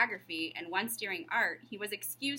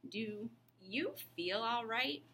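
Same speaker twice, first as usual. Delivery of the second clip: slow, softer worried teacher; slowly; 2 semitones higher, 5 dB quieter, slower than usual. Acted. Teacher asks John if he's well, slow and soft. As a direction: draw out the concern. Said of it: "said Miss Plimsoll slowly".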